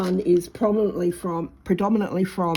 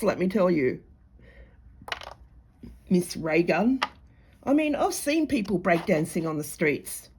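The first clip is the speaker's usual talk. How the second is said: usual speaking voice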